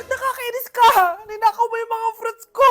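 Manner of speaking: angry whining. Acted. Kid angry over stolen fruits.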